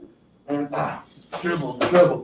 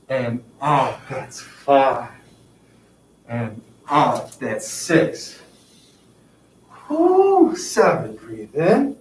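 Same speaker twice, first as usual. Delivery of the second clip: soothing instructor counting breaths; calm breathing cues; 4 semitones lower, slower than usual. Acted. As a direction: coach the breath in and out calmly. Leads slow breathing stretch.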